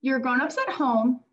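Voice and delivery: gentle read-aloud to a class; picture-book reading to children; reading like a teacher. Acted. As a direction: read it like a teacher to young kids. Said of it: "I'm gonna read it like a teacher".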